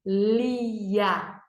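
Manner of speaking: Lia drawn out as "llli-ia"; stretched syllables. Shown as a demonstration. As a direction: stretch Lia slowly in two parts. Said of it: "Duas sílabas". (Lia esticado: "llli-ia")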